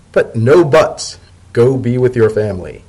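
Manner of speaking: firm loud order. Acted. Dodded firmly ordering Spinning home.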